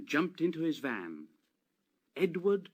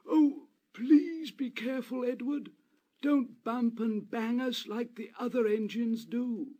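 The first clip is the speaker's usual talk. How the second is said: much higher, pleading little coach voice; pleading coaches; 9 semitones higher, 3 dB louder than usual. Acted. Coaches asking Edward not to bump them, high and pleading. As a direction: beg him gently in a high coach voice.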